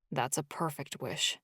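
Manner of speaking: quiet, loving murmur; tender and soft. Acted. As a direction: hush it like a caress. Husband answers her wish tenderly.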